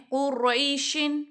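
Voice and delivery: قریشن drawn out slowly, every sound clear; slow demonstration. Shown as a demonstration. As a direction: model قریشن slowly for the class. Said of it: "آگے اگر دیکھیں". (قریشن آہستہ پڑھ کر دکھانا)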